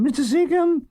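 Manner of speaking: high calling Seacombe up the stairs. Retold or imitated. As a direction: call Seacombe high, rising. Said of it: "I hear a call".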